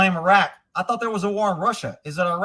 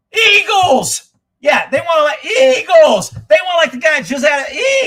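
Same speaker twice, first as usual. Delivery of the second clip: louder and higher, yelling in outrage at the voters; furious shout; 4 semitones higher, 6 dB louder than usual. Real, not acted. Ranting vlogger yells at voters who elect Biden, furious shout. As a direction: bellow it out, fed up and disgusted.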